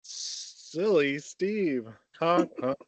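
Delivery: high, very drawn-out silly call with honks; playfully goofy name-game call. Pretend. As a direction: stretch the name, then honk like a horn. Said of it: "passing the emotion that goes to it".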